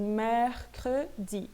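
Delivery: mercredi broken up slowly, first E wide open. Shown as a demonstration. French teacher demonstrating the open E, slow and exaggerated. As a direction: stretch out "mehr-kre-di" with an open first vowel. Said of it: "eu pronuncio bem aberto mesmo".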